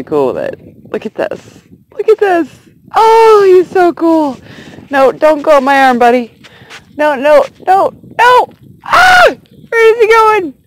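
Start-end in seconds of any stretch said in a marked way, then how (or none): 1.9-10.5 high, loud, panicky delight; real thrilled shriek building to a yelp; excited squealing. Real, not acted. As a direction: squeal as the bug crawls up your arm. Grasshopper crawling up her arm.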